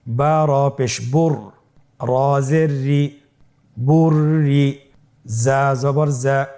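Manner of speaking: slow, even chant of letters then syllables; spelling aloud. Shown as a demonstration. Teacher has learners spell along, slow chanted spelling. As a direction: draw out each syllable for repeating. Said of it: "আমার সাথে বানান করুন".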